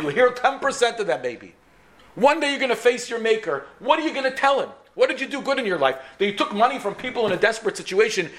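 high-pitched, insistent retold scolding. Wife takes doctor to task over his life, scolding.

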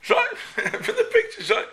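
high laughing punchline. Retold or imitated.

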